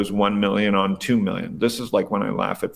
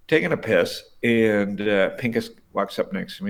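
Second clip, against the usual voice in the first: ordinary talk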